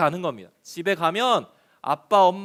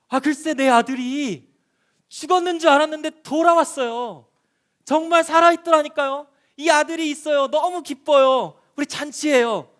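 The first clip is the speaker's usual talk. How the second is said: much higher and louder, bursting with joy; overjoyed father; 7 semitones higher, 5 dB louder than usual. Shown as a demonstration. Preacher acting the joyful father telling the village, high and loud. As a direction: shout the news like a father wild with happiness. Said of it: "아빠는 너무 기뻐서".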